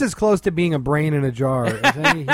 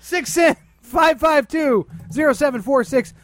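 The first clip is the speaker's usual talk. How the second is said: hotline plug shout; 8 semitones higher than usual. Pretend. Host calling out the show's hotline number, shouted plug.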